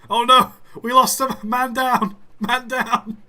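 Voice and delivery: loud high panicked yelling; man down shout. Real, not acted. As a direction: shout the alarm at the crash. Train crash in game.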